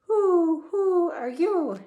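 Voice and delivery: high, loud hooting owl. A narrator's reading. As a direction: hoot it slowly like an owl. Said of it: "the owl said".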